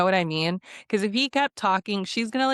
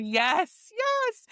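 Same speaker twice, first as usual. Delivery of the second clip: high-pitched yes, rising higher; emphatic relieved cheer; 13 semitones higher than usual. Retold or imitated. Viewer hearing some sense finally, relieved cheering. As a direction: celebrate it with a high second yes.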